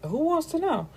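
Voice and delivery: high, snappy who-wants-to-know; sassy challenge. Retold or imitated. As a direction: toss it back with attitude. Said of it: "And she's like".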